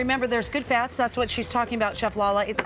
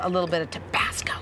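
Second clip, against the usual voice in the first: whisper; 4 semitones lower than usual. Real, not acted. Aside about adding hot sauce.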